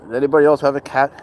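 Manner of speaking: ordinary talk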